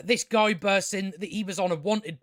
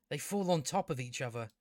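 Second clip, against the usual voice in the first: lower, much softer restatement; deadpan repeat; 4 semitones lower, 7 dB quieter than usual. Real, not acted. Reviewer repeating a silly plot point, low and deadpan. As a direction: drop it down in deadpan disbelief.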